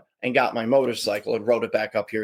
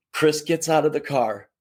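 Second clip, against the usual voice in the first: usual speaking voice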